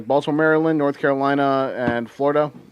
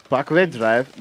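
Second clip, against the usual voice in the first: German accent; slower than usual. Shown as a demonstration. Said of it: "Can you say it in, like, a German accent?".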